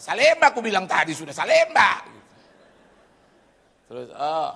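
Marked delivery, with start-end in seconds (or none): 0.0-2.0 loud, irritated bus driver; impatient shout. Retold or imitated. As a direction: yell back the destination, exasperated. Retold driver barking destination at him.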